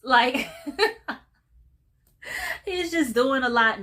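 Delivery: laughing while talking; giggling through the words. Real, not acted. Laughing at the character's antics.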